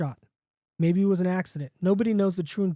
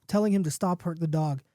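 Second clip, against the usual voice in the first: ordinary delivery, nothing marked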